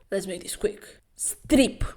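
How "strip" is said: curt command. Acted. Jae-kyung commanding.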